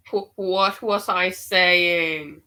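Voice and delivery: startled repeat. Real, not acted. Reader stunned as spirit echoes her words, slow and amazed. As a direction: stretch it out in disbelief. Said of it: "spirit confirms what I'm saying".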